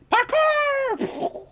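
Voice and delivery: high squealed shout of glee; excited yell. Acted. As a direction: yelp it out as you leap. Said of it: "and you're like".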